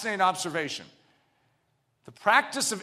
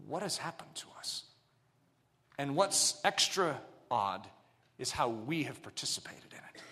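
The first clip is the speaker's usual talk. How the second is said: hushed; 5 semitones lower, 8 dB quieter than usual. Acted. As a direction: lean in and confide. Confessional aside, dropping low.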